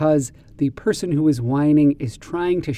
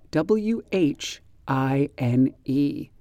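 slow spelling; much slower than usual. Shown as a demonstration. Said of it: "spells word".

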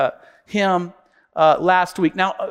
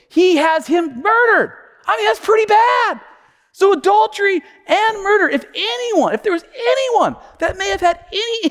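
emphatic sermon delivery, pitched up, with weighty pauses; impassioned preaching; 7 semitones higher than usual. Real, not acted. Preacher on King David's sins, impassioned preaching. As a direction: proclaim it to the whole congregation.

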